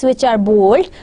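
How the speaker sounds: usual speaking voice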